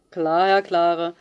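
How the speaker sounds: loud, slow drawn-out mock reply; a pupil mocks the teacher's name loudly; cheeky call. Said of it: "rief der Junge".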